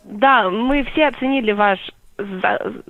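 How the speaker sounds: usual speaking voice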